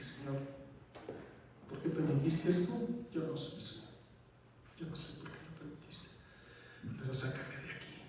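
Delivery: soft, whispered plea to God; hushed prayer. Retold or imitated. Praying on knees in cell. (oración susurrada y suplicante)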